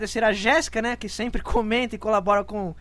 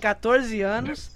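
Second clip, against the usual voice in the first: ordinary talk, nothing marked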